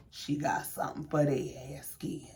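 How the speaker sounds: whispered, unvoiced and hushed; sly whisper. Real, not acted. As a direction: lean in and whisper it like gossip. Teasing aside whisper.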